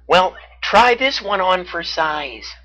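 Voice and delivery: high, slow challenge call. A narrator's reading. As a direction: shout a playful dare from above. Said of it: "Baxter the Beaver shouted down".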